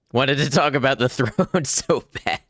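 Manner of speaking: real laugh breaking into the words; amused, laughing mid-sentence. Real, not acted. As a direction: crack up while admitting it. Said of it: "I'm laughing because".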